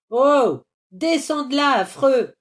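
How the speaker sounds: put-on cross old man shouting a scolding; angry shout. A narrator's reading. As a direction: holler up at a naughty child. Old man yells at boy on roof.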